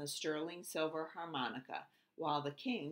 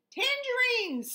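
much higher, stretched out slowly; excited Elmer; 10 dB louder than usual. Acted. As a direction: sing out the word with glee. Elmer answers proudly.

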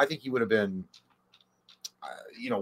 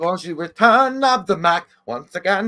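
higher, louder mocking voice; 5 semitones higher, 5 dB louder than usual. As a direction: tease him in a silly put-on voice. Mocking Brian with a put-on voice.

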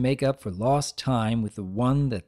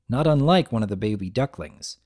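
plain reading voice

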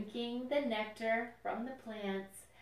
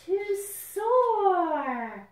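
soaring; 10 semitones higher, 9 dB louder than usual. Acted. Climax of life cycle.

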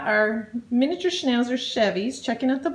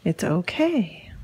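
lower, slow and soothing; calm the dog down gently; 7 semitones lower, slower than usual. Real, not acted. Owner calming nervous dog, low and slow.

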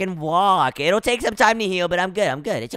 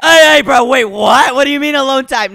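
loud, startled objection; alarmed protest; 2 semitones higher, 8 dB louder than usual. Acted. Patient backing off from nurse's advances, alarmed. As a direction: burst out loudly in alarm.

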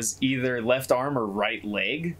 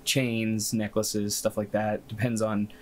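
ordinary talk

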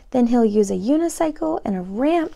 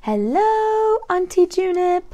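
cheerful greeting; 8 semitones higher, 5 dB louder, slower than usual. Acted. Tiny greets his aunt.

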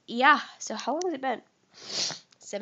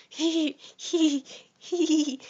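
creepy giggle; 6 semitones higher, 4 dB louder, much slower than usual. Pretend. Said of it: "Is that creepy?".

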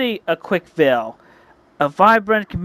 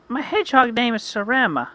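heroine voice; 7 semitones higher than usual. Acted. Heroine introduces herself in story.

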